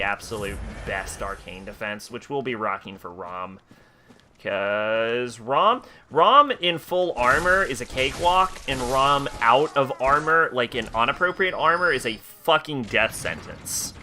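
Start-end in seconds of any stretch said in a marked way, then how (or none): 4.4-13.9 emphatic. Real, not acted. Emphatic warning about armor.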